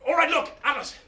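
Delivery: ordinary delivery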